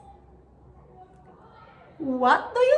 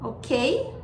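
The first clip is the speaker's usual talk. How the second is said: ordinary talk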